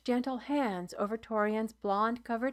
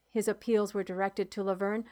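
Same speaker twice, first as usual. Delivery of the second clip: plain reading voice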